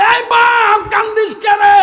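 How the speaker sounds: high, loud cry of a worried mother; mother crying out to her son, high and loud; alarmed call. Acted. Mother cries out to weeping son.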